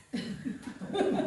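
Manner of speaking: breathless chuckle; soft laugh. Real, not acted. Preacher laughing at his own question, soft chuckle. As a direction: laugh it off lightly.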